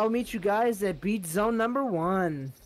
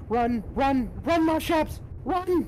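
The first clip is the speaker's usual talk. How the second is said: frantic high yelling; panicked shouts to flee; being chased; 8 semitones higher, 4 dB louder, slower than usual. Real, not acted. Creature fleeing a predator.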